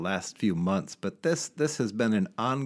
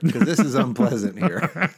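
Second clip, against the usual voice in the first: laughing; 7 semitones higher, 7 dB louder than usual. Pretend. Jokingly voicing himself in airport.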